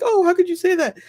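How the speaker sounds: mock-scandalized mother, pitch jumps way up; hammed-up horrified mother; shocked mom.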